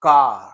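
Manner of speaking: car बिना आर के, का जैसा; car में आख़िरी आर मत बोलिए; British car. Shown as a demonstration. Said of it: "They said". (British उच्चारण में car, आर के बिना)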